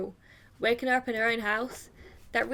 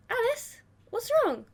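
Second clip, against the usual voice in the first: put-on much higher girlish voice; concerned girl character; 11 semitones higher, slower than usual. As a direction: put on a high voice, asking what's up. Voicing girl in visual novel.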